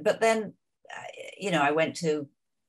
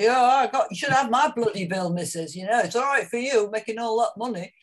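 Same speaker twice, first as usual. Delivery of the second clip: louder, higher voice of a grumbling bloke on the street; hammed-up cheeky cockney complaint, rougher and louder; a louder, higher cockney man moaning about his bill; mock cockney punter; 6 semitones higher, 6 dB louder than usual. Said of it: "people say".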